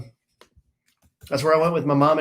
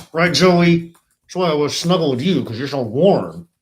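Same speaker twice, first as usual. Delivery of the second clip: high, sweet pet-talk voice; doggy talk; 3 semitones higher than usual. Real, not acted. Dog owner cooing at her sweet dogs. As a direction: coo over the dogs.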